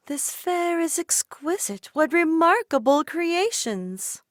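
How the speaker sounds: admiring the treats with lofty delight; delighted cafe patron. A narrator's reading. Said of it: "monitor the patrons".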